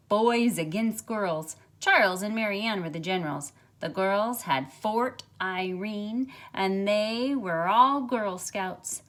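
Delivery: put-on lively, expressive read-aloud for children; storybook reading. A narrator's reading. Teacher reads picture book aloud.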